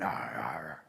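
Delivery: very low chomping growls; bear eating noises. Acted. Storyteller plays Bear continuing to eat, low munching noises.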